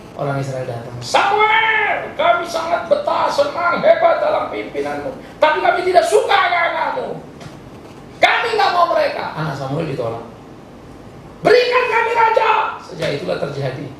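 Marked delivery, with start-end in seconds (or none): 1.0-9.3 high, drawn-out mock delegation calling out; high-pitched hammed-up crowd spokesman; Israelites' appeal. Israelites come to Samuel to reject his sons, high mock appeal. 11.4-12.8 high, slow calling demand; hammed-up crowd demand, pitched way up. Israelites demand a king from Samuel, high drawn-out cry.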